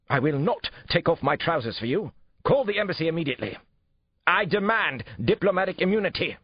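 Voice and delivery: high, strident English outrage; outraged demands. A narrator's reading. Suspect demands diplomatic immunity from arresting detective, outraged shouting.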